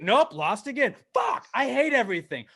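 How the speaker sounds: exasperated outburst at losing yet again; mock despairing anger. Fan giving up after another loss, mock furious. Acts out repeated disappointment.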